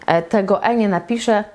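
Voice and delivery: ordinary talk, nothing marked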